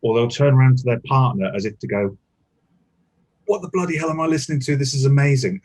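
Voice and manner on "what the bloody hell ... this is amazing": higher, louder, fast blurt of amazement; amazed yelling. Retold or imitated. Headphone listener turns to their partner, amazed and yelling. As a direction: imitate a headphone listener exclaiming too loudly. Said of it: "they're yelling because they have headphones on".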